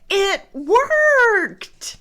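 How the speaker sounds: high, loud delighted cry; joyful cry. Acted. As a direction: cry out in relief. Gerald relieved.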